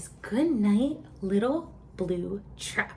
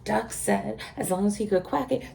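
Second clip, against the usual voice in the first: ordinary delivery, nothing marked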